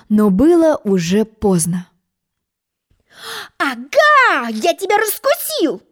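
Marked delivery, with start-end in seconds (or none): 3.6-5.8 high, indignant outburst; mock-furious egg; angry yell. A narrator's reading. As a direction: catch her out, furious. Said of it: "гневно завопил".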